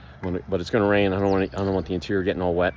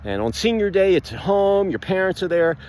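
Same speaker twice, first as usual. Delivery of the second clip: ordinary delivery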